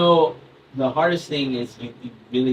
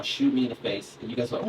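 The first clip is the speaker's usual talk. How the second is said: ordinary talk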